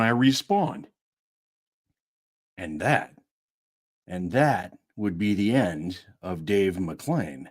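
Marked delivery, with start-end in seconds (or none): 2.6-7.5 low and slow, ominous; low slow dramatic take; lower, slow take. Shown as a demonstration. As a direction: drop it down, weighty. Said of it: "retake lower and slower".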